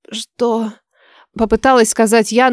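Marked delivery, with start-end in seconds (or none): 0.1-0.7 weak, quiet croak; pitiful croak. A narrator's reading. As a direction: try to speak through a strangled throat. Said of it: "получился какой-то жалкий сип". (слабый жалкий сип)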